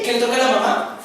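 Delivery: normal talking voice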